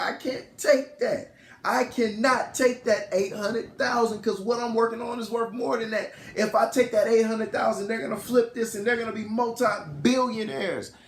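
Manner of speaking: high-pitched, insistent argument; acted-out exasperated refusal; retold indignant protest. Retelling husband arguing with wife.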